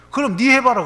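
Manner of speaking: loud, high imitated comeback; irritated retort. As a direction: throw the advice back at them loudly. Retorts to friends' unwelcome advice.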